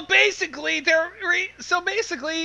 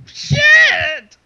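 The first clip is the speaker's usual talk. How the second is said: shocked curse; 8 semitones higher, 9 dB louder than usual. Real, not acted. Players react to rabbit flood.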